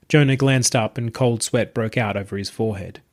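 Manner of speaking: plain reading voice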